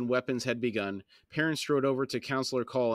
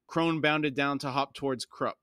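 plain reading voice

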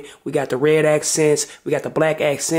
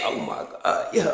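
ordinary delivery

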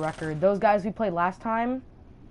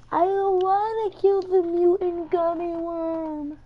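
put-on high childish whine; little kid talking, pitched way up; preschooler voice; 10 semitones higher, 4 dB louder than usual. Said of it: "Louis talking his little kid voice".

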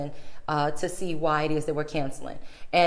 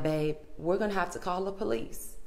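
quiet resigned; 8 dB quieter than usual. Retold or imitated. Grim decision to husband.

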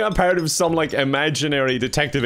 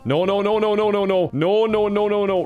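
alarmed rapid noes; 6 semitones higher than usual. Real, not acted. Robot slipping in game.

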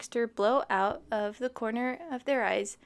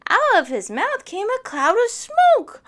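amazed narration; 9 semitones higher, 10 dB louder than usual. Acted. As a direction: voice climbs high and loud in wonder.

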